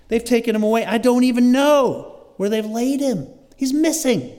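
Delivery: high, urgent voicing of Mary's alarm; pleading, worked-up retelling of her complaint; acted distress. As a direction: raise the pitch as if desperate for answers. Voices Mary's distress over missing Lord.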